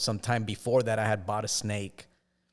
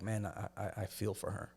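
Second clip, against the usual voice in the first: quiet, softer musing; soft inner thought; 10 dB quieter than usual. Retold or imitated. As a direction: mutter it to yourself with regret.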